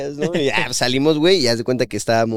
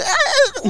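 high raging sob-cry, half laughing; crying rage cry. Retold or imitated. Boy pummelling the older kid, retold crying rage yell. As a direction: let out a tearful angry yell. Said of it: "como llorando y con coraje".

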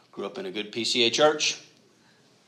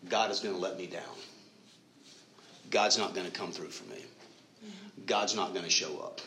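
softer, lower, deflated self-doubt; mopey inner voice; 3 semitones lower, 6 dB quieter than usual. Retold or imitated. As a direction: sulk it out like a discouraged believer. Preacher voicing a doubting believer.

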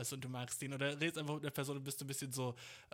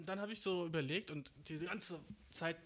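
normal talking voice

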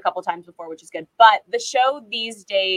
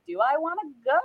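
hesitant self-questioning; 6 semitones higher than usual. Retold or imitated. Teen weighing up an offer from Stanford, hesitant. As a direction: re-enact your own doubt, pitch climbing. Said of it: "then I was like".

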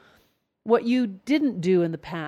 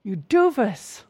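acted exasperated self-reproach; scolding inner voice. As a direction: chide yourself in disgust. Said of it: "here comes the inner voice".

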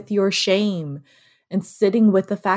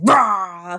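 short, louder cartoon roar of rage; angry monster roar; 5 dB louder than usual. Imitating the angry character on Inside Out, roaring. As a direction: imitate an exploding anger character. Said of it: "where it's just like".

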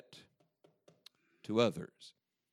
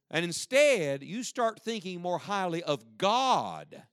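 preaching at full force; 4 semitones higher, 4 dB louder than usual. Acted. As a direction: drive the point home to the church. Preaching climax to the congregation.

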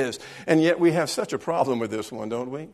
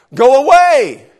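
screamed retort; 12 semitones higher, 16 dB louder, slower than usual. Retold or imitated. Daughter yelling at dad again.

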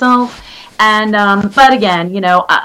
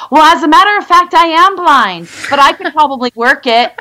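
sassy retort; 6 semitones higher than usual. Retold or imitated. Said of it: "I said".

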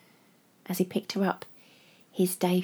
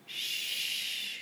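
shushing; 12 dB quieter than usual. Acted. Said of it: "she told her".

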